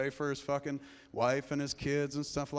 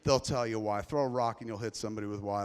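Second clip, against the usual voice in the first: normal talking voice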